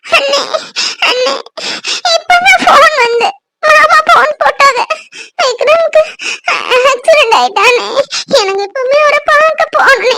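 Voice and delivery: high, broken tearful wail; sobbing. Acted. Sister telling her brother of the accident, sobbing. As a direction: sob out the bad news between gasps. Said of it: "ஏன் அழுதுட்டு இருக்க?".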